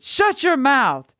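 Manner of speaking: high, slow, forceful command; angry shout. A narrator's reading. Another farmer silencing the girl, high drawn-out shout. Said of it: "shouted another".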